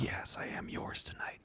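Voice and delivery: slow, surrendering whisper; seductive whisper. Retold or imitated. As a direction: whisper back slowly, giving in. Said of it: "And you go".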